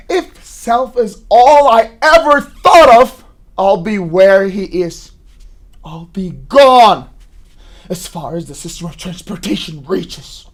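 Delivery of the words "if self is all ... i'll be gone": loud, slow and emphatic; defiant outburst. Acted. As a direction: hammer home each word. Defiant climax to mother.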